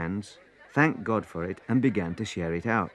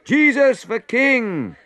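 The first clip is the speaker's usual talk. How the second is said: high, drawn-out rallying cry; loud, high acclaim; shout; 14 semitones higher, 6 dB louder, much slower than usual. A narrator's reading. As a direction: shout it slowly to start a chant. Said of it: "Then someone shouted".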